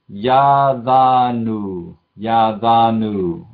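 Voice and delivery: yadanu drawn out very slowly, each syllable clear; slow teaching demo of yadanu; slow model word. Shown as a demonstration. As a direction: spell out yadanu in sound for students. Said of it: "teacher models the word".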